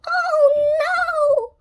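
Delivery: high, whispered cry of dismay; high dismayed witch. A narrator's reading. Winnie seeing rain coming through the roof, dismayed cry. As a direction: gasp it out as the witch. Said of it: "said Winnie".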